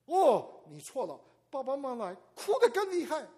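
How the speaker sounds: 高而响地讲述孩子大哭; 激动地加重讲; 越说越激动. Retold or imitated. Retelling grandson's escalating crying. (激动高声讲述)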